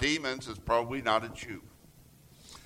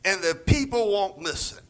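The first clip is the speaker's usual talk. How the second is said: higher, louder complaining rant; letting loose; 6 semitones higher, 5 dB louder than usual. Retold or imitated. Elijah venting angrily at God, higher and louder. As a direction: pour out the grievance in one burst. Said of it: "Elijah just lets loose".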